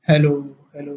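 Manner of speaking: hello fading like a mic echo. Retold or imitated. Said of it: "एक बंदा बोलता है".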